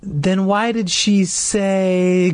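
slow, high, breaking voice. Acted. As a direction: let the voice crack with worry. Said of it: "his voice broke".

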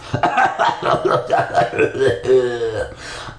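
long creaky villain cackle, trailing off; dry coughing laugh. Acted. Ghost boy gloating at trapped kids, dry laugh. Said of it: "more like a cough than a laugh".

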